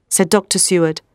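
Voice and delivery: ordinary delivery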